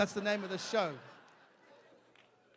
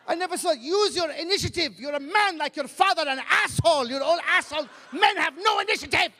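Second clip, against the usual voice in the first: higher, louder exasperated scolding; scolding mother; 6 semitones higher, 4 dB louder than usual. Retold or imitated. Mother scolding her son at the kettle, higher and louder. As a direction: nag him like a fed-up mother. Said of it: "She goes".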